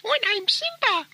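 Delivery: very high, squeaky put-on pet voice; Simba. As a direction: pipe up as a talking dog. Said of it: "have a quick word with Simba".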